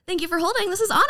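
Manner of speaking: bright, chirpy phone answer; customer service voice. Retold or imitated. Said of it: "sounding like my smoker self".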